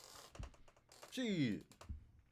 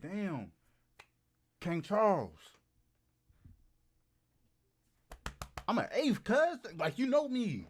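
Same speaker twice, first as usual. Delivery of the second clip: excited; 5 semitones higher, 6 dB louder, slower than usual. Acted. Hyping up British royal ancestry.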